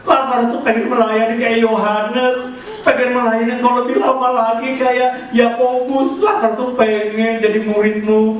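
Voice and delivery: high, aching lament to the Lord; grieving plea. Retold or imitated. Mary grieving to Jesus over Lazarus's early death. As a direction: plead through held-back tears. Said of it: "itu pesannya begini".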